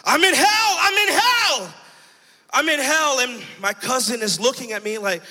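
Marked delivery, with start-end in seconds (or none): 0.0-3.2 high, loud yell in full earnest; reenacted screaming. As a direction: scream it out, pitch leaping far up. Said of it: "I'm yelling and I'm screaming".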